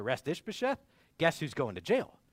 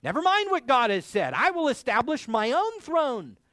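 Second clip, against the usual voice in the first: much higher and louder proud defiance; the rebel voice rejecting God's word; defiant boast; 9 semitones higher, 7 dB louder than usual. Retold or imitated. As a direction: proclaim it against God. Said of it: "Why would you resist".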